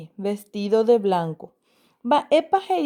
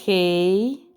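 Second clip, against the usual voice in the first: despacio, palabra por palabra, como dictando; léelas despacio para los chicos; lectura lenta; 3 semitones lower than usual. Shown as a demonstration. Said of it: "Vamos a leer las palabras". (lectura pausada de maestra)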